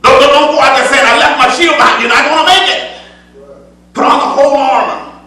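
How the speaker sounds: full-voiced preaching with rising fervor. Preacher exhorting the congregation in full preaching voice. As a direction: preach it out to the church.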